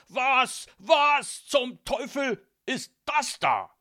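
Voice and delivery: high, loud, slow outraged splutter; furious disbelieving father; aghast stammering. A narrator's reading. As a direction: force the words out one at a time, appalled and shouting. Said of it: "stotterte er".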